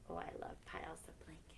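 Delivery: quick, hushed aside; whispered aside. Real, not acted. Aside to kids while reading.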